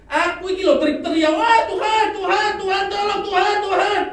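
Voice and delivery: loud desperate cry of the prophet; Habakuk crying out. Retold or imitated. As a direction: wail for God to hear you. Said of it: "Itu Nabi Habakuk ngomong".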